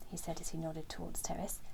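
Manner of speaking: ordinary delivery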